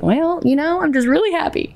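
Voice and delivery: high, sheepish gush; sheepish happy reply. Retold or imitated. To the laughing friend, a high sheepish reply. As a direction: answer high and a bit embarrassed. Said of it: "And I was like".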